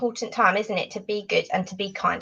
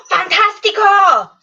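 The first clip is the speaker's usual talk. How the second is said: higher, louder cheer; excited praise; 9 semitones higher, 7 dB louder than usual. Real, not acted. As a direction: cheer the class on.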